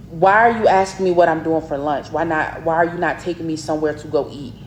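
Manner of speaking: usual speaking voice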